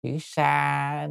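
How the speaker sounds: "sa" held long and slow; long vowel. Shown as a demonstration. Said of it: "nó dài một chút". (kéo dài chữ "sa")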